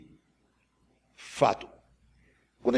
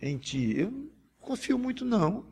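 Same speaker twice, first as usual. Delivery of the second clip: intimate confessional prayer to God; humble prayer; 4 semitones lower than usual. Acted. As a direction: pray it honestly, like a weak disciple. (oração humilde e confessional)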